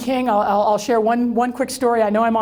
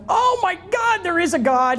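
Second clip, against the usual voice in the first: a sudden burst of disbelieving relief; overjoyed gasp; 9 semitones higher than usual. Retold or imitated. Speaker hearing her staff will be hired, overjoyed exclaiming. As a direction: pitch leaps way up on Oh my God, then settles.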